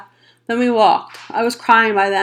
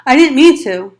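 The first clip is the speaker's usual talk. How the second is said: loud, higher mock of his brother; mocking shout; 4 semitones higher, 6 dB louder than usual. A narrator's reading. Darry shouting mockingly at Ponyboy, loud. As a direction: throw his words back at him. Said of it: "Darry shouted".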